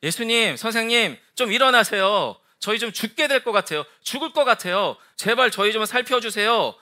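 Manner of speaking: high, urgent plea for help; disciples pleading. Acted. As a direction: beg a sleeping teacher to wake and save you. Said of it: "제자들이 어렵게 이야기합니다".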